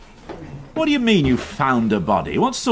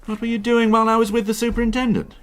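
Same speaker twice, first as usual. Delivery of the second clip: higher, fast, impatient question; irritated demand; 11 semitones higher, faster than usual. Acted. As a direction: fire it at him, annoyed. Irritated inspector questions sergeant.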